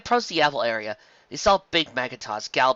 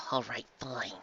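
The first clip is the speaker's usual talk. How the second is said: muttered; 11 dB quieter, slower than usual. A narrator's reading. Trixie grudgingly giving in to Carrot Top, in a soft mutter. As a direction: give in under your breath. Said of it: "she muttered".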